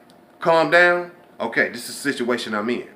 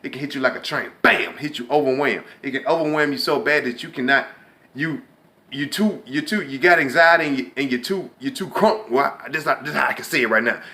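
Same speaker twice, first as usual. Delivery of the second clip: ordinary delivery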